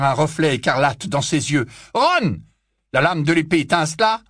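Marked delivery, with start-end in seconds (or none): 1.9-2.4 alarmed cry. Acted. Harry calls as Ron turns.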